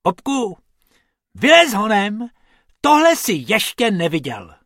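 high, loud urgent call. A narrator's reading. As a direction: call a friend out to see something. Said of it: "volal Bob".

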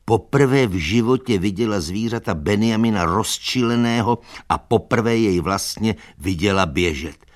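ordinary delivery

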